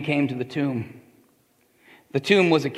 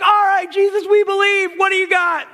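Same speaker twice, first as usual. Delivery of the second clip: very high, loud crowd cry; excited shout; 7 dB louder than usual. Acted. Witnesses telling Jesus they believe, excited shout. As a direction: yell it as a convinced crowd.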